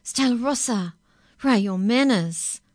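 mother scolding her daughter loudly; scolding cry. A narrator's reading. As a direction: tell off a child sharply. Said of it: "her mother cried".

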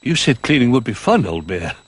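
little bear after the adventure; relieved excitement. Acted.

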